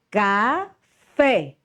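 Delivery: Café split slowly, strong open FÉ; teacher's demo of Café, stressed FÉ; stress on the last syllable. Shown as a demonstration. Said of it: "essas palavras que eu estou pronunciando".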